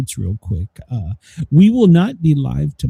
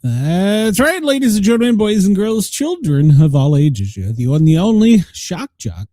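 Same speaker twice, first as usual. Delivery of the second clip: booming hype-man intro; big, loud showman welcome; radio announcer; 4 semitones higher, 5 dB louder than usual. Acted. Host's hyped show opener.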